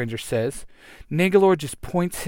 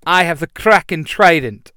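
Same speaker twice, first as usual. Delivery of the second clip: triumphant yell; 3 semitones higher, 8 dB louder than usual. Acted. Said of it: "the Blue Ranger yells".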